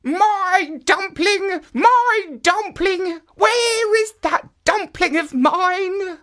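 put-on high, slow old woman calling; old woman crying out. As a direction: call out for the lost dumpling. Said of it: "still crying out".

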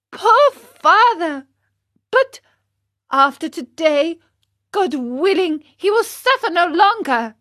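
high, loud puppet voice full of feeling; earnest Pinocchio, pitying. Acted. Pinocchio hears of his father trembling with cold, pitying. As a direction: cry out in pity, then vow firmly.

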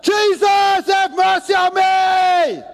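high, loud, slow crying out; desperate shout. Retold or imitated. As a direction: cry out with everything you have. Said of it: "cried out even louder".

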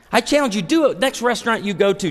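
normal talking voice

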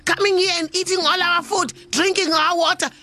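high, cheeky taunting voice up top; small creature shouting defiance; tortoise yelling taunts. Acted. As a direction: pipe up at the monster, daring it.

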